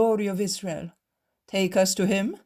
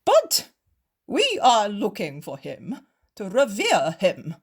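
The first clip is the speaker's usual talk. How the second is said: loud, wheedling, slower in places; fawning; 5 semitones higher, 6 dB louder than usual. Acted. Cowed Pharisees beg the innkeeper, fawning. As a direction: grovel like creeping pups. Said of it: "the two Pharisees change tone".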